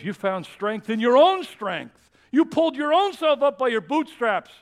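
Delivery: loud, high booming rebuke; stern divine rebuke; God's voice. Pretend. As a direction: speak as God correcting them. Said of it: "And God says".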